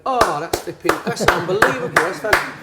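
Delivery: mock the fans' sudden delight, bright and loud; imitating delighted fans. Liverpool fans react to flowers.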